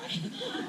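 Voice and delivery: a quick, high nervous laugh; high breathy laugh. Real, not acted. Teacher laughing at her own emotion, high quick laugh. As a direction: laugh lightly, fighting back tears.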